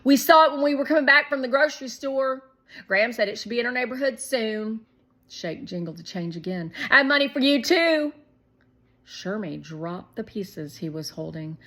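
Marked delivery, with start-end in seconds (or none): 0.0-4.8 put-on high, loud and fast kid excitement; excited little boy. A narrator's reading. Shake telling Shermie about the ice cream money, excited. 6.9-8.1 put-on high, loud and fast kid excitement; excited little boy. A narrator's reading. Shake telling Shermie about the ice cream money, excited.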